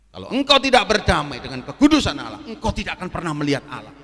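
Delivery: lantang dan menekan; peringatan khotbah. Real, not acted. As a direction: peringatkan jemaat dengan seruan keras. Memperingatkan jemaat dengan berkhotbah. (peringatan tegas seorang pengkhotbah)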